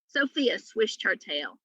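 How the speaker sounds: plain reading voice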